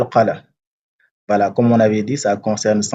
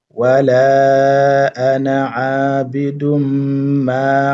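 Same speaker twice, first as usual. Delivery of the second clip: slow, drawn-out chanted recitation; Quranic recitation; 4 semitones higher, slower than usual. Shown as a demonstration. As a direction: recite it slowly, stretching the vowels. Full surah recitation with class.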